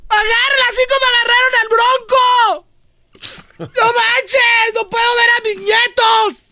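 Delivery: very high wailing shout; mock-distraught shrieked plea; hammed-up ex-mother-in-law. Grandmother begs Obrador over her grandchildren, hammed-up high wail. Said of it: "la ex suegra dijo".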